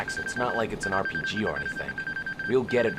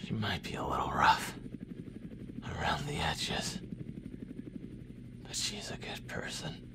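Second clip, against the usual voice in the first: wounded, barely voiced; faint injured whisper; weak whisper; 5 semitones lower, 7 dB quieter than usual. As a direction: breathe the words out in pain. Wounded soldier fading out.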